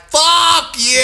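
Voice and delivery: high loud "Fuck you!"; yelled at driver. Retold or imitated. Parking, yelling back at a honking truck, high loud shout. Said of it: "and I was like".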